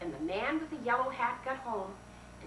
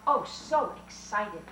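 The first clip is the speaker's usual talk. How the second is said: ordinary talk, nothing marked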